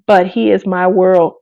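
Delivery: ordinary talk, nothing marked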